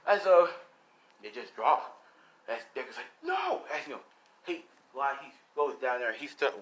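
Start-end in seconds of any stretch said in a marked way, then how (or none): 3.2-3.6 very high-pitched outcry; acted horrified cry. Deku seeing bombs drop, high horrified cry.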